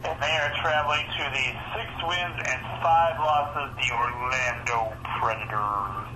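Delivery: sports announcer reading the records; lightly hyped matchup call; announcer intro. Acted. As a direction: introduce the teams like a broadcast.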